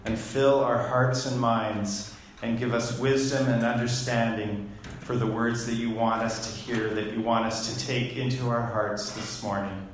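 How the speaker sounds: low, softened prayer voice; opening prayer. Real, not acted. Pastor asking God for wisdom before the message, prayerful.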